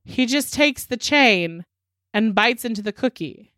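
normal talking voice

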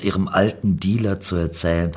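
ordinary delivery

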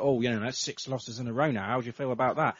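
hint of a sarcastic reporter asking Ralph; mock reporter. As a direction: toss the question off like a bored reporter. Mimicking the reporter's question.

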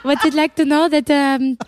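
put-on a playful French accent. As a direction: fake French accent, halting. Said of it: "Your French accent is so good".

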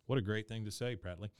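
ordinary talk, nothing marked

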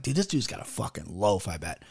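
a low, hushed mock aside to the boys; muttered aside. To the boys in the group text, a hushed muttered guess. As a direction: say it under your breath like a sly guess. Retold private jab to the boys.

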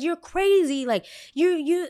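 high teasing jab; mocking taunt. Kid teasing his mom in the video, mock taunt.

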